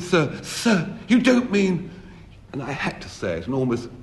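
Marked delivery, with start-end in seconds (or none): 0.0-1.9 high, rising, pleading sir, sir; pleading disbelief. Retold or imitated. The caught friend begging the narrator, in shocked disbelief. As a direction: beg as if you can't believe it.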